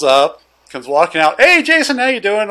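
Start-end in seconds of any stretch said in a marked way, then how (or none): 1.4-2.5 loud, high-pitched hail; imitated friendly greeting. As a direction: call out like a cop greeting a buddy. Acts arriving cop greeting him.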